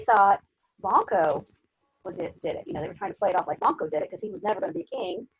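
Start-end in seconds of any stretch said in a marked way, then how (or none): none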